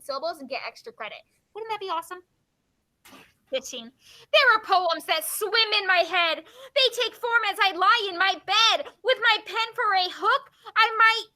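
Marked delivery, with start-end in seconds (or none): 4.3-11.3 lilting, rhythmic verse reading; limerick reading. Acted. Teacher reading a limerick to the class, lilting recital. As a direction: bounce on the rhymes, voice lifted.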